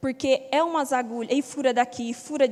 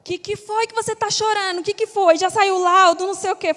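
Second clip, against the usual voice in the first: friend on the phone; 6 semitones higher, 4 dB louder than usual. Retold or imitated. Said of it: "a primeira pessoa que eu liguei, Má".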